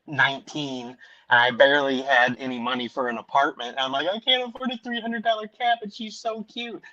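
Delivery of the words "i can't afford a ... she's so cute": high-pitched wistful quote; imitating a torn young buyer; retold torn pleading. Retells pleading over shelter cat.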